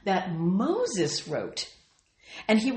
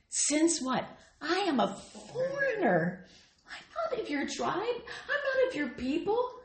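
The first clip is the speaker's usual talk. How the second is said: humble Ruth; 8 semitones higher than usual. Acted. Ruth voiced humbly, pitch high.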